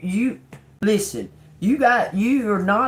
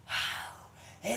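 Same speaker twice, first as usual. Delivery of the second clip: awed wow; 5 semitones higher, 13 dB quieter than usual. Retold or imitated. Grandson awed at the door.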